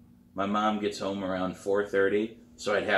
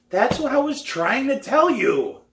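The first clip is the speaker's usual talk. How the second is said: high, loud frustrated protest; exasperated outburst; 7 dB louder than usual. A narrator's reading. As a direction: burst out in exasperation, pitch way up. Hurt pupil protests to drum teacher.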